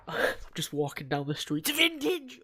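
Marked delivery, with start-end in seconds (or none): 1.7-2.4 high-pitched sudden commentator catchphrase; Michael Cole. Retold or imitated. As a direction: burst out like a wrestling announcer. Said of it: "I've been listening to Michael Cole".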